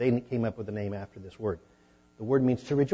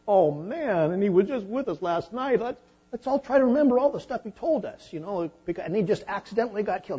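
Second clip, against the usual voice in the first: high, loud mock-lament of a shocked disciple; mock mourning disciple; 10 semitones higher, 7 dB louder than usual. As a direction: ham up the shocked friend, high and bright. Mock disciples mourning after accident.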